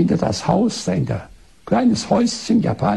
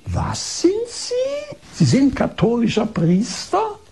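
very high, slow stunned cry; shocked disbelief; 6 semitones higher, slower than usual. Retold or imitated. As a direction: recoil as if struck, voice shooting up. Als ob sie einen Schlag bekommen.

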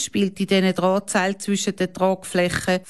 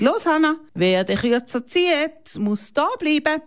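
put-on challenging boy; 8 semitones higher than usual. A narrator's reading.

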